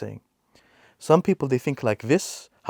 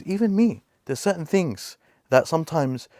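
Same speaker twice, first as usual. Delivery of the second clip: ordinary talk, nothing marked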